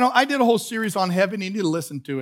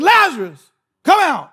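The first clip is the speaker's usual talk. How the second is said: loud, higher-pitched commanding call; 6 semitones higher, 7 dB louder than usual. Acted. Preacher showing how Jesus summoned Lazarus, in a loud voice. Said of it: "said it in a loud voice".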